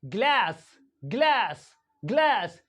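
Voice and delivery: GLASS as 'glaes', front 'ae' vowel; American A. Shown as a demonstration. Teacher drilling American English, 'ae' in GLASS. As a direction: say glass with the A of cat. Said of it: "in American English".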